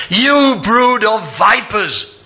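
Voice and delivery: slow venomous denunciation. Shown as a demonstration. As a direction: hiss it with venom like a rebuke. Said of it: "with venom in his voice".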